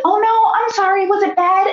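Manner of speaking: imitated high-pitched concern; concerned sympathy. Midwife to suddenly tearful patient, worried and sympathetic.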